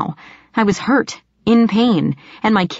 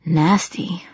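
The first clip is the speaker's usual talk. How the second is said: half-whispered, breath-only remark; hushed reaction. A narrator's reading. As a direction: let it out under your breath. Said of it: "Rob whistled softly".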